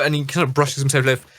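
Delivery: ordinary delivery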